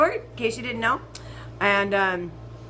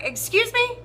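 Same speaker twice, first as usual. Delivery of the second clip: much higher, offended "Excuse me?"; indignant excuse me; 12 semitones higher than usual. Retold or imitated. Passenger snapping back at rude bus driver, indignant. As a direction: fire it back, shocked and affronted.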